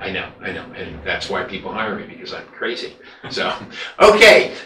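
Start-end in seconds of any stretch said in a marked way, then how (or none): 3.3-4.7 laughing. Real, not acted. Laughing after crazy joke.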